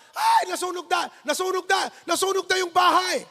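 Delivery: high panicked cry of a neighbour; panicked neighbour shouting fire; mock panicked neighbour yell. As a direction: shout it like the house is burning.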